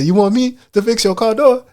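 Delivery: put-on incredulous skit hero with African accent. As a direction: ask in an imitated African accent, rising. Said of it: "little accents like that".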